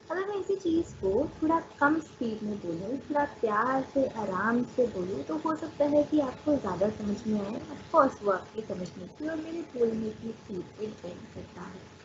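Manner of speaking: slow, softened and unhurried. Shown as a demonstration. Teacher showing students gentle speech, very slow. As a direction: take it gently, easing through each phrase. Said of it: "the second one was very slow".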